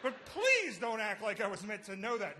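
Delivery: high-pitched exasperated retort; snapping back. Retold or imitated. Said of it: "that's when I snapped".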